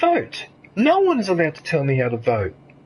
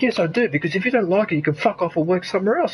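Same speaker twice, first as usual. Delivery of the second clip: ordinary talk, nothing marked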